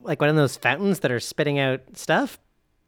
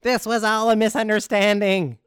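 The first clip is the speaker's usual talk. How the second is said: mild shout, pitched up; calling after them; 6 semitones higher than usual. Acted. Muldar calls after retreating guards, a mild shout. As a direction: raise the voice a little to call after someone. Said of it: "Muldar will shout after them".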